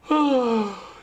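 high, loud demonstrated sigh; demo sigh. Shown as a demonstration. Teacher listing body sounds, demonstrating a sigh. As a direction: let out a big audible sigh. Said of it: "It could be any kind of".

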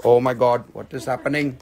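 ordinary talk

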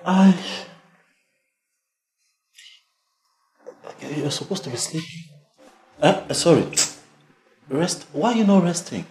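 high flustered fussing. Acted. Boyfriend fussing high-pitched over sick Kemi. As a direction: fluster and fuss over her.

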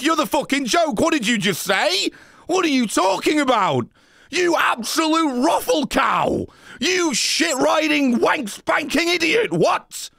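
high-pitched ranting, building to a shout; exasperated berating. Real, not acted. Streamer berating a chatter over a bad joke, exasperated shouting.

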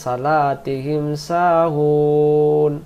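সালাতিহিম সাহুন with light স, drawn out slowly; mistaken reading. Shown as a demonstration. Teacher showing students the wrong reading slowly. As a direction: stretch সাহুন slowly with a thin স. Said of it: "এখন আমরা যদি বলি".

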